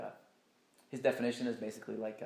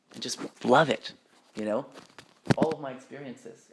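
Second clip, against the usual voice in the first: ordinary talk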